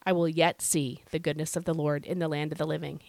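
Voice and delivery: normal talking voice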